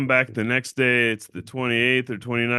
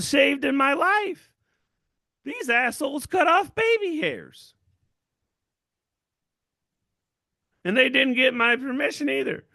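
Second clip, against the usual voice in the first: high, whiny indignant complaint, pitched way up; mock outrage; 11 semitones higher, 3 dB louder than usual. Squeaky outraged whine. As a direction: rant to the audience about the shaving, in a high mock-outraged whine. Indignant rant about being shaved.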